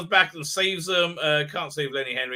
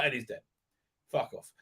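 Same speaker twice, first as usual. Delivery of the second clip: ordinary talk, nothing marked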